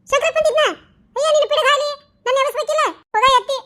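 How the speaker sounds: high, agitated telling of the dream; upset rush. Acted. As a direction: blurt it out still shaken. Woken woman, upset, to husband. (ಎಚ್ಚರಗೊಂಡು ಗಂಡನಿಗೆ ಕನಸು ಹೇಳುವ ಆತಂಕದ ಹೆಂಡತಿ)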